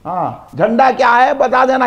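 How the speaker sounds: ordinary delivery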